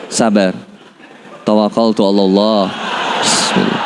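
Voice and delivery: low, composed, softening to a breath; steadfast calm. Retold or imitated. Husband stays firm before his family, calm. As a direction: act composed, pitch dropped well down.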